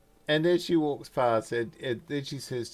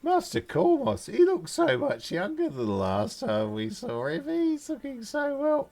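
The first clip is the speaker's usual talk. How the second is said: gushing gnome woman; 4 semitones higher, slower than usual. Acted. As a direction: fawn over an old friend in a high voice. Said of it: "half gnome woman looks at you, goes".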